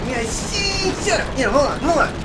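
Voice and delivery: high nervous squeaky snitch; rat voice. Retold or imitated. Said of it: "You gotta sound like a rat".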